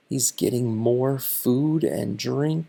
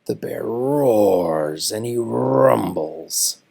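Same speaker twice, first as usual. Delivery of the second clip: growly bear reading; 5 semitones lower, slower than usual. A narrator's reading. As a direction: grow into a grumpy bear. Reading bear's rising temper.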